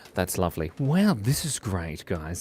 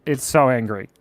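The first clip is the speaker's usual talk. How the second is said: normal talking voice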